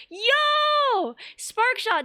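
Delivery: a gleeful yelp at good news; excited yo. Real, not acted. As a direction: burst out in delighted surprise. Excited at chat message.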